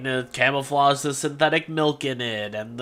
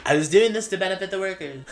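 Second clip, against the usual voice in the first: Donald Trump; 3 semitones higher, 3 dB louder, faster than usual. Acted. Businessman denying he is a monopoly, put-on accent. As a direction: put on a presidential accent, brisk and defensive. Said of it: "give him a Donald Trump accent".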